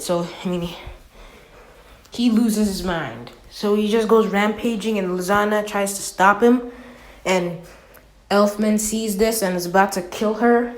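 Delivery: usual speaking voice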